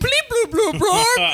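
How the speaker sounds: high, slow, far-off robot bleeps; high robot beeping. Retold or imitated. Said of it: "his one line in this show is".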